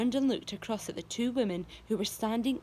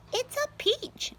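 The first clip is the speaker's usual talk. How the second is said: high, slow excited cry; shouting; slower than usual. A narrator's reading. Aunt Spiker shouting to Sponge about the peach. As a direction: call out the discovery in a high voice. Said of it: "Aunt Spiker was shouting".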